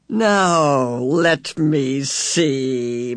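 very slow, drawn-out musing; pondering. A narrator's reading. Missus Whatsit considers the sandwich choices, pondering slowly. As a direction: stretch each word as you think it over. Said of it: "Missus Whatsit pondered".

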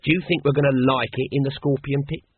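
ordinary delivery, nothing marked

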